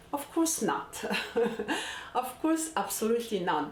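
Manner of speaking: high, amused denial with a laugh; laughing emphatic no. Real, not acted. Mother answering a parent's question, laughing, high and emphatic.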